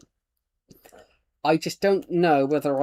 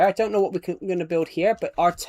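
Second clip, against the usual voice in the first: usual speaking voice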